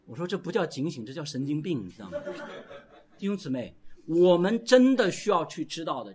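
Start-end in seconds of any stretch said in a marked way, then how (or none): none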